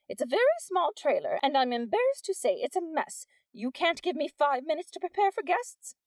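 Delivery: high, put-upon polite appeal; theatrical plea. Acted. Missus Wack stalling the crowd, theatrically polite. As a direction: beg for a moment.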